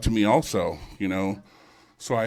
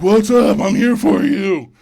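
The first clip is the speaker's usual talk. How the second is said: high, loud bro greeting, slowing down; douchey ghost hunter; 10 semitones higher, 10 dB louder, slower than usual. Retold or imitated. Ghost hunter greeting a spirit in a douchey bro voice. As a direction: ham up a cocky TV ghost hunter. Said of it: "he's like".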